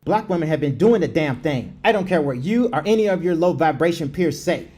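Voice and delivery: normal talking voice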